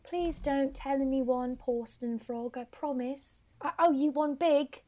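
high, soft put-on girl voice; dainty slow begging; pleading Juliet. Juliet begs the frog to keep quiet, high pleading voice. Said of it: "Juliet's like".

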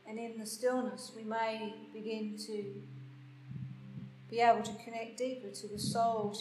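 guided meditation. Acted. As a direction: guide them gently into stillness. Leads a meditation.

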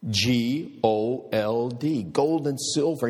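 "G O L D" letter by letter, slow, then "gold" rising high; spelled out slowly. Retold or imitated. Retelling Dad spelling out his vision, slow and deliberate. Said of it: "spelled it for me with the emphasis on the G".

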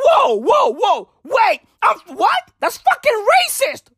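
high squeaky shocked reaction, then a laughing thanks; high startled shock. Retold or imitated. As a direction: act floored then grateful. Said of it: "You be out there like".

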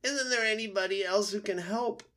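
high, loud wailing plea; fox in pain. A narrator's reading. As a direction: wail for anyone else to help. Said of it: "howling in pain".

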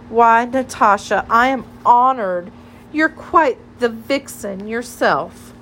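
imitating a smitten admirer; mock flattered suitor. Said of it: "he'll put his hand over his heart and say".

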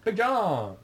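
drawn-out playful holler; calling out. Acted. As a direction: call out the name, pitch up then falling. Calls out in game character's role.

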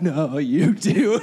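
creepy insistence. Retold or imitated. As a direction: insist eerily, hammed up. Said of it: "Jesus is like".

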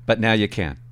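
ordinary talk

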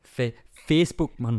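mock Geordie twang on "Facebook, man"; Geordie accent. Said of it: "read out in a Geordie accent".

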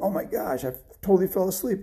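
high-pitched, startled waking; startled realisation. Acted. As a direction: wake up with a jolt of surprise. Father wakes startled at oversleeping.

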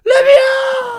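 very high, loud pretend cry to be let out; mock desperate plea. Joking about someone trapped in the house, hammed-up cry. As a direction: beg to be freed like a trapped victim.

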